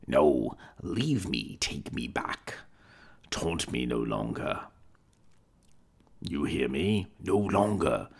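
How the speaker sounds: put-on low old man's voice, desperate pleading; begging the ghost to stop haunting him; old Scrooge, desperate.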